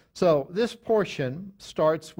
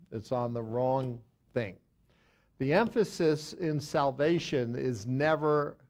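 ordinary talk

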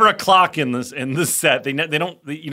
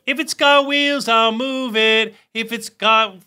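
high, very slow hammed-up British voice; mock British accent; 9 semitones higher, 5 dB louder than usual. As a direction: ham up a cheery British accent. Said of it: "he was British, by the way".

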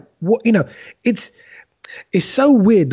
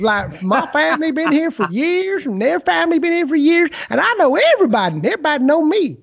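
put-on American small-town accent; 11 semitones higher than usual. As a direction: play a proud local who never left town, American accent. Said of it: "your, your American accent then".

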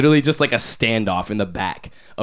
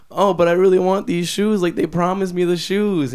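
high, loud imitation of a begging kid; pleading child; 9 semitones higher, 6 dB louder than usual. Little girl begging granddad for shoes, high mock pleading.

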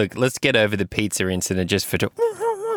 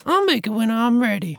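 very high puppet wife voice; Missus Fisty. Acted. Missus Fisty answering the decision question, high and coy. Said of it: "She's like".

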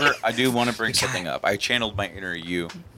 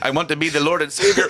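Jesus voice; 6 semitones higher, 5 dB louder, faster than usual. Acted. Voicing Jesus in bit.

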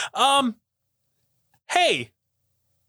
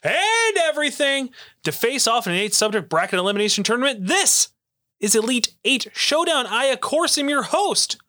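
ringmaster; 3 semitones higher than usual. Acted. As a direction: announce it like a ringmaster. Show host big intro.

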